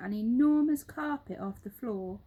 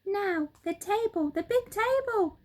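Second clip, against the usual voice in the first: high, louder eager command; shout; 11 semitones higher, 5 dB louder than usual. Acted. Mugglewump ordering the table up, high shout. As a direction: call the next job. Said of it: "shouted Mugglewump".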